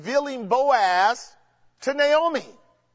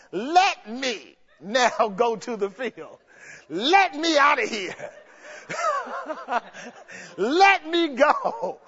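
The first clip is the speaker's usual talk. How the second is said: laughing plea; 2 semitones higher, slower than usual. Retold or imitated. Ruth begging, laughing.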